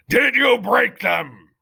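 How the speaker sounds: slow, loud demanding question; stern head mouse. Acted. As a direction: press him for the truth.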